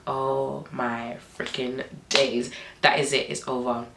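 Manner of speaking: low, slow, drawn-out dread; quiet dismay. Retold or imitated. Friends caught by police on the quad bike, quietly dismayed. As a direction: sink into hushed resignation.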